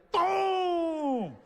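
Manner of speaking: drawn-out, high punch sound effect; long high yelled boom; big impact boom. Retold or imitated. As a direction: boom it out like a huge hit landing. Acted sound of the final punch.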